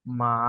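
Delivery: মা begun low, in rising-tone style; second tone. Shown as a demonstration. Teacher reading the four tones in order, second tone. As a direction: start মা low and lift it. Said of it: "আবার সবার বোঝার সুবিধার্থে".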